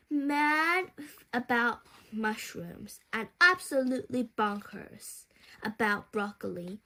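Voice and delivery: usual speaking voice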